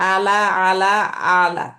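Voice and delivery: ala said with the ain from mid-throat; careful Arabic ala. Shown as a demonstration. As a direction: stretch out "আলা" with a throaty ain. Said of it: "এখানে একটা জিনিস".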